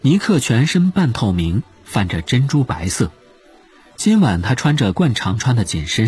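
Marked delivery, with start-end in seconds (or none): none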